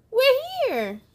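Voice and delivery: high gasping we're here; excited arrival. Acted. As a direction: gasp with delight at arriving. Gasp of arrival in story.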